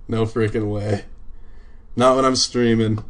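usual speaking voice

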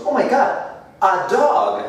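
high-pitched pretend fright; mock alarm. Someone about to be bitten by a dog, mock alarm. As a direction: yelp it in playful panic. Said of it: "Si nos va a morder decimos".

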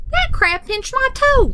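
high-pitched storybook complaint; pained complaint. A narrator's reading. Boy pinched by a crab on the beach, pained high complaint.

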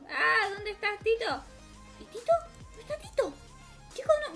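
high, whimpering call for the dog; a sad, high wail; whiny crying. Real, not acted. Can't find her dog.

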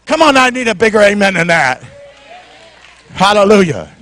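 ordinary delivery

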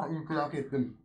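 sudden sneeze bursting out; sneezing. Real, not acted. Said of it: "İnfilak edeceğim galiba". (hapşırma)

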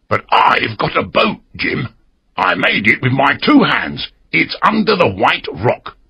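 lightly voiced castaway proud of his boat; old castaway. A narrator's reading. Castaway tells Jim about his boat, eager. Ben Gunn offers boat.

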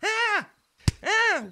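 startled high yelps. Acted. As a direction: cry out in fright. Ted yelps in fear.

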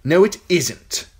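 put-on high, sneering young king; cruel boy king. King taunting the captive girl, high and sneering. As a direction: gloat down at her, spoiled and cruel. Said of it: "the king replied".

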